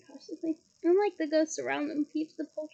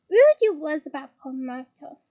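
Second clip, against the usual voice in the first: louder, taunting sing-song; 11 dB louder than usual. Acted. Peeves teases Hermione again, louder and mocking. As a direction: lean into it louder, teasing.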